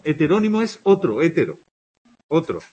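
ordinary talk

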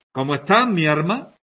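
arma said with r for alma. Shown as a demonstration. Teacher showing Sevillian l-r confusion. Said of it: "Mi alma, es lo que quieren decir".

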